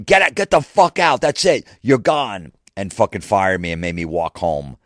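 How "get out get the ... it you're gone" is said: high, loud scream easing slightly at the end. Retold or imitated. Boss throwing fired employee out of the van, high screaming. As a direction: scream him out of there for good.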